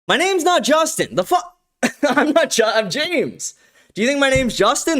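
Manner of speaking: high, incredulous protest about the name; real amused disbelief at the wrong name; indignant surprise. Real, not acted. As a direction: act baffled they got the name wrong. Chat called him wrong name.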